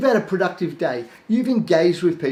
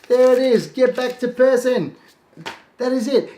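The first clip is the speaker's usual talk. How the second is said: higher and louder throughout; enthusiastic; 8 semitones higher, 4 dB louder than usual. Shown as a demonstration. Coach showing students how easy it is, enthusiastic. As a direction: sell the simplicity with energy. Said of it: "demo of capturing".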